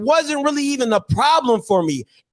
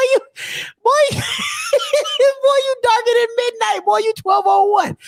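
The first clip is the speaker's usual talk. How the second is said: laughing roast; 12 semitones higher than usual. Pretend. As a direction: yell the insult through laughter. Laughing roast repeated loudly.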